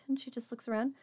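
ordinary talk, nothing marked

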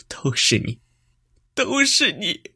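rising to a high, loud wail; anguished cry. A narrator's reading. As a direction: break into a desperate cry. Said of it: "喊道".

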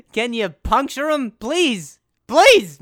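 high, loud begging building to a yell; hammed-up desperate plea; desperate pleading. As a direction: plead frantically, last please belted. Acts begging lake to help him.

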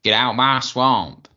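loud, drawn-out ogre roar; big hammed-up cartoon ogre voice; Shrek. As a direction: bellow it slowly like a grumpy ogre. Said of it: "you know the character Shrek".

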